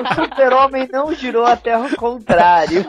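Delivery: words breaking into real laughter; laughing while talking. Real, not acted. Podcast cohost laughing as he corrects the Superman joke. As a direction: giggle through the correction.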